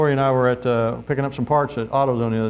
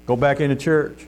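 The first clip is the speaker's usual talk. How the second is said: ordinary talk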